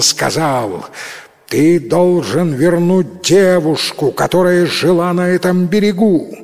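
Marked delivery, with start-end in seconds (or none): 1.5-6.4 loud, high, firm demand; unshaken demand. Acted. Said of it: "непоколеблённый".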